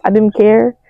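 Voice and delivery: usual speaking voice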